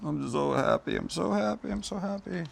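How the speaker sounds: rising, giddy repeats; giddy food joy; delighted singsong. Real, not acted. Delighted over the cooked steak.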